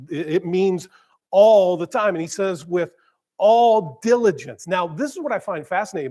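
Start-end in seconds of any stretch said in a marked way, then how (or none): 1.3-2.1 "all" punched louder, rising; stress on all. Shown as a demonstration. Preacher quoting a friend on what all means, stress on "all". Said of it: "all means all". 2.6-4.7 "all" louder and slow; stress on all. Shown as a demonstration. Preacher repeating the verse, heavy stress on "all". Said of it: "writing with emphasis".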